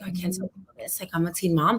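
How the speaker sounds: dropped to a near-hush, ashamed. Retold or imitated. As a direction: say it like you're afraid someone hears. Retelling her past self's shame.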